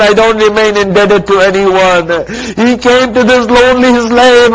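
loud, high, rising majlis preaching; impassioned preaching. Acted. Preacher driving a mourning crowd to climax, impassioned. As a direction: build the grief to a peak.

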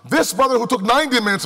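ordinary delivery

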